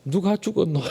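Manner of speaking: high, lightly voiced Gyeongsang dialect child's question. Preacher to congregation, lightly voiced Gyeongsang dialect. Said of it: "그래서 경상도 말로".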